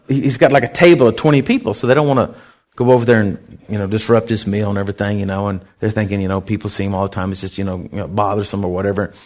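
normal talking voice

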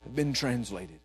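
flat sci-fi officer delivery; Spock. Retold or imitated. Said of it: "It's like Spock".

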